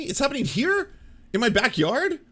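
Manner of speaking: high, loud disbelief; shocked. Real, not acted. Learning feral hogs invade Canada, real shock. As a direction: react with real astonishment.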